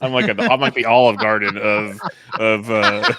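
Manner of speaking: amused, searching for the word while laughing. Real, not acted. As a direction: trail off into giggles. Cracking up at own bad impression.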